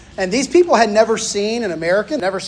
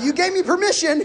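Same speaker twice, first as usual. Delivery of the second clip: laughing reminder; 9 semitones higher than usual. Retold or imitated. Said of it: "I was like".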